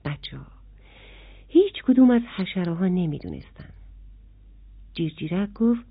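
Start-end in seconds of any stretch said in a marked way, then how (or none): none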